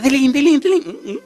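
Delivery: high, loud "tilín" jingle; imitated bell ringing. Storyteller mimics the woman ringing her bell hard, high jingle. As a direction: ring it out like a handbell.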